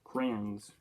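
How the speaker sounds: "Crayons" run together as "crans"; one-syllable crayons. Shown as a demonstration. Vlogger trying out a word, "Crayons" as "crans". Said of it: "never known how to pronounce it".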